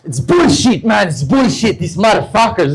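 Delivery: put-on high, heated Brazilian-accented English. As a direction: imitate a Brazilian swearing indignantly in English. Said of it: "Brazilian guy".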